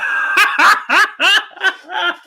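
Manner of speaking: high cackling laugh; long, high-pitched burst of laughter; real laughing fit. Real, not acted. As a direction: crack up mid-quote in a high laugh. Breaks up quoting viewer.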